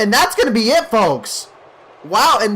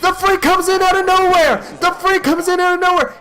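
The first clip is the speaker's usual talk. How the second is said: much higher, louder screaming play-by-play; frantic shocked shout; 11 semitones higher, 4 dB louder than usual. Real, not acted. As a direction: yell it like the crowd just exploded. Freak suddenly attacks, commentator erupts.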